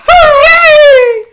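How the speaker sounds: long, very high, loud cheer; full-voiced stretched witch's cheer; triumphant shout. A narrator's reading. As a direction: shriek it out in gloating triumph. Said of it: "all us witches shout".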